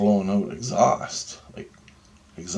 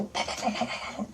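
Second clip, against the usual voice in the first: knocking noise; 14 semitones higher, 9 dB quieter than usual. Retold or imitated. Imitating knocking on the door.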